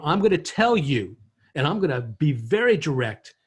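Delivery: loud, direct and firm; assertive voice. Shown as a demonstration. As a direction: tell them straight, with force. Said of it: "is the assertive voice".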